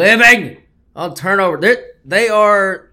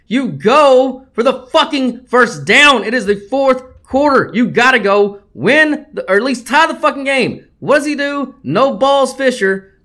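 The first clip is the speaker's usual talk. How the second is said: higher and louder, slowed and incredulous; exasperated shout; 7 semitones higher, 4 dB louder than usual. Real, not acted. Host ranting about Jimbo Fisher's fourth-down call, exasperated shout. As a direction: rail at the coach who wouldn't go for it.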